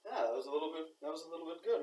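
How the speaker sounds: a high, pleasantly surprised audience; an audience grudgingly won over; impressed audience. Retold or imitated. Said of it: "and they go".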